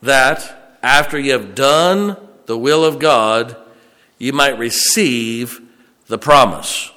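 loud, slow, hammered-out verse; full-force preaching. Acted. Preacher thundering the verse to the congregation. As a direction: drive each phrase home, slow and loud.

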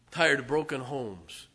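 rising from firm to loud preaching; building sermon cry. Real, not acted. Preacher listing what he is tired of, building to a shout.

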